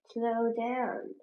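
plain reading voice

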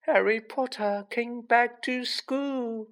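high, slow, woeful little voice; miserable elf. A narrator's reading. Tearful house elf to the boy he warned, miserably. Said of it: "he whispered miserably".

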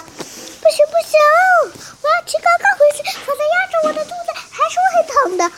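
put-on high, fast childish wail; boy cries it hurts, high and fast; whining child. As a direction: cry out in pain like a little kid. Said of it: "他的孩子喊道".

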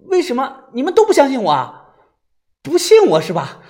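high, loud wronged appeal; hurt, indignant outcry; aggrieved. Acted. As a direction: challenge them for not believing you. Wronged man confronts disbelieving friends.

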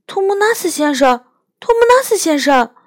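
a mild touch of sorrow in a quick high call; sadly. A narrator's reading. Lucy calling the weeping faun, lightly sad. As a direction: call his name, sad and urgent. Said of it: "Lucy难过地说".

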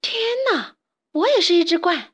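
very high, shocked cry; loud exclamation. A narrator's reading. Isabella seeing she is a stork, crying out. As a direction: shriek it out in amazed discovery. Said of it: "大叫起来".

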